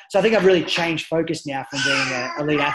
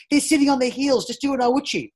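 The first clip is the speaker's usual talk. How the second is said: high, loud coaching call; 10 semitones higher, 5 dB louder than usual. Retold or imitated. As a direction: shout the fix across the mat. Coach calling advice to athlete.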